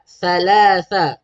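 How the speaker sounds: "Thalatha" slowly with th sounds; careful pronunciation. Shown as a demonstration. Teacher modelling the tha letter for parents. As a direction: lisp the th in "Thalatha". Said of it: "Keluarkan hujung lidah".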